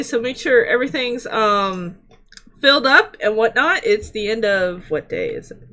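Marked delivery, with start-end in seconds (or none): none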